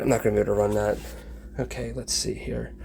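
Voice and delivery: usual speaking voice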